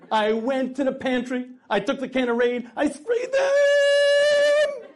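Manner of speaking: building to a high, slow, laughing-crying yell; wife telling her husband she beat the cockroaches, triumphant; triumphant shout. Acted. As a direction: celebrate the victory over the bugs. Proud wife tells of cockroaches.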